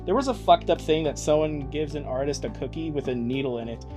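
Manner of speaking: normal talking voice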